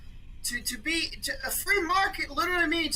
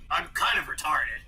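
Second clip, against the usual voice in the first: low gravelly radio-host voice; Alex Jones impression; 8 semitones lower than usual. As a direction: drop low and growl it. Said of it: "to quote Alex Jones".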